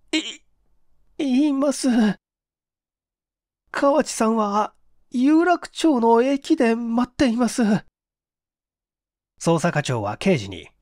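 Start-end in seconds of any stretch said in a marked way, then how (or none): 0.1-7.6 put-on high, slow, giving-in young man; higher, slow, beaten confession; cowed confession. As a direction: break down and confess. Okamoto gives in.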